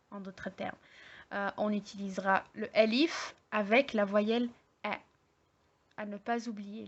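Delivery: ordinary talk, nothing marked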